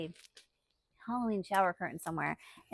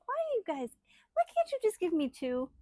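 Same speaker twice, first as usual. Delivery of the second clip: mock exasperation; 8 semitones higher, faster than usual. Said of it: "I'm like".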